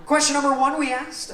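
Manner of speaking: bright rising question announcement; game show host. Acted.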